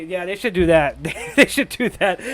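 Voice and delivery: normal talking voice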